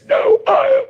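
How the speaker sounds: wife insisting. Retold or imitated. Preacher voicing his wife insisting she's sorry, pitched high.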